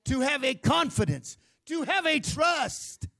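high, loud fervent preaching; full-force preaching. Acted. Pastor urging the congregation to trust God's word, fervent preaching. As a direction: drive it home to the congregation.

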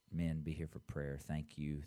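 ordinary talk

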